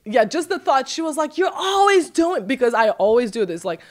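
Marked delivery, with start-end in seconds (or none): 1.4-2.4 high, drawn-out mock complaint; reproachful mom. Mother complaining about daughter's adventures, retold in high slow reproach. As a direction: imitate an exasperated mother, slowly.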